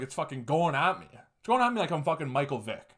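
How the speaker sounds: ordinary delivery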